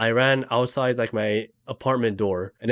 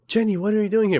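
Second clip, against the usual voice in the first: higher-pitched mock-startled question; 8 semitones higher, faster than usual. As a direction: act out being taken aback by who walked in. Acted startled question in retold dream.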